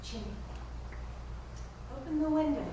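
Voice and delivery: frail, lingering deathbed murmur; hushed dying heroine. Acted. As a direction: let the words fade away tenderly. Plays heroine's dying scene.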